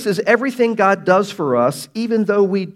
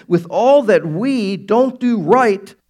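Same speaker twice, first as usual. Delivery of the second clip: building preacher's call; 4 semitones higher, 5 dB louder, slower than usual. Real, not acted. Preaching climax.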